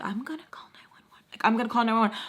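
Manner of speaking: urgent fast whisper; hushed panicked whisper about calling for help. Retold or imitated. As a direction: whisper it fast under your breath. Whispering urgent thought, unvoiced.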